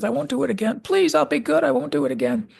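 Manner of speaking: put-on high, childlike pleading; high whining promise not to misbehave; begging Charlie. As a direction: promise to be good like a desperate kid. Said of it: "he's just begging Kirk".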